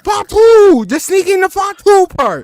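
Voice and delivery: very high, loud rallying yell. Shown as a demonstration. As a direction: holler it like a war cry.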